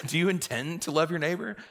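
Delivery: high mock interrogation; pointed questioning. Priest quizzing the doubting parishioner, high and mock-insistent. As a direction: quiz them with playful insistence.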